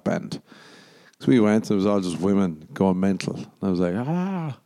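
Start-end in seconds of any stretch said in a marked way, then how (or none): 4.0-4.6 high soft ah; thrilled fan squeal; excited squeal. Retold or imitated. Women going mental at concert.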